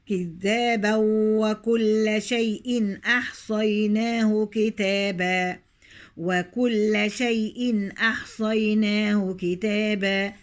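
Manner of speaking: كذابا وكل with tanween merged into the waw; idgham demo. Shown as a demonstration. Teacher demonstrates merging tanween into waw, slow recitation. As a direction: glide كذابا straight into وكل. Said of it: "هنا أضغمت".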